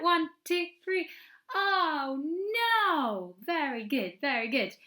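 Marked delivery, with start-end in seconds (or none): 1.5-3.3 high, drawn-out dismayed oh no; big oh no. Shown as a demonstration. Teacher modelling the story's big oh no, high and dramatic. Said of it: "Can we practice that".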